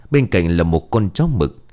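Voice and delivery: plain reading voice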